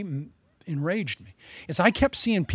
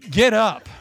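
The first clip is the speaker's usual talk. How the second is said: rising, louder command to get up; rally them to get up; urging call; 3 semitones higher, 6 dB louder than usual. Real, not acted. Preacher urging his congregation to get back up.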